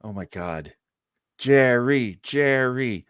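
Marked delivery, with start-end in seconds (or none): none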